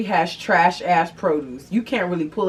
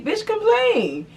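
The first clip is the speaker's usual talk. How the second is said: mock whiny complain; slower than usual. Mock Karen telling you to complain.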